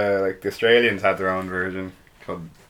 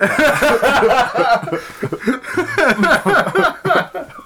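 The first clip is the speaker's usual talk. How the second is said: long high laugh; 6 dB louder than usual. Real, not acted. Laughing at the accent.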